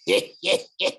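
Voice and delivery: breathless unvoiced wheezing laugh; an asthmatic fit of laughter; wheezy laugh. A narrator's reading. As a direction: airless wheeze of a giant laughing. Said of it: "His brother Orius laughed".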